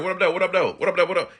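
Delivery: "What up, though" rolled quickly off the tongue; said as one word. Shown as a demonstration. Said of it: "It's like one word".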